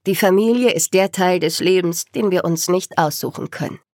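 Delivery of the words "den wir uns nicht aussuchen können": ordinary delivery, nothing marked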